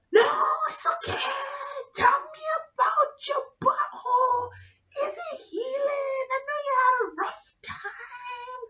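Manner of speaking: put-on pushy drunk prying in a squealed shout; drunk doctor. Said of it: "He is drunk".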